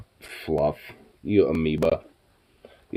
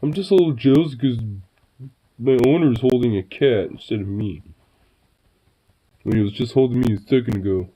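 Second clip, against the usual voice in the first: voicing the dog; 2 semitones higher than usual. Owner voicing her jealous dog, lightly put-on voice. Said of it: "It's like".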